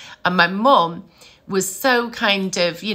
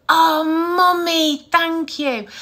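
put-on high, drawn-out child's thanks; retold little boy; 9 semitones higher, 3 dB louder, slower than usual. Small son thanks mum for banana and ice cream, imitated, high and drawn out. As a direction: imitate a grateful small child, pitched well up. Retelling son's delighted dessert thanks.

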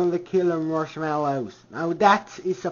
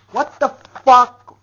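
loud, higher outraged yell; angry shout; 6 semitones higher, 10 dB louder than usual. Real, not acted. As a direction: blast it out in disbelief. Rant peak.

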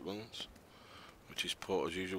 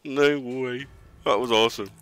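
excited; 4 semitones higher, 10 dB louder than usual. Real, not acted. Celebrating after the fight.

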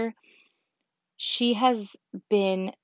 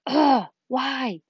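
mock frustrated; 5 semitones higher, 5 dB louder than usual. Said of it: "I'm just like".

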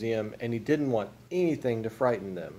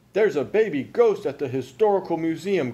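louder, higher agitated report; indignant demand; 6 semitones higher, 5 dB louder than usual. A narrator's reading. Mister Frizzle phones the police, loud indignant demand. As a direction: demand action urgently.

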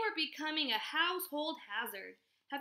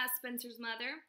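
ordinary delivery

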